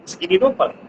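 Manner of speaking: ordinary delivery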